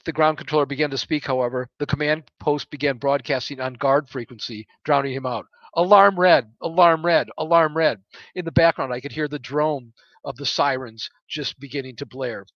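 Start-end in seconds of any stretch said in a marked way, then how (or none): 5.7-8.0 urgent alarm call. Acted. Command post broadcasts alarm.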